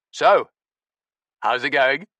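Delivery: booming matey hello; Stinky greeting the narrator, loud and hearty. Acted.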